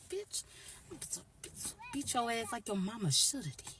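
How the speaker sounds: high, strained threat to throw them out; a put-on angry guy's voice, high and tight; mock furious yell. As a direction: bark it like a fed-up man kicking them out. Homeboy yelling them out.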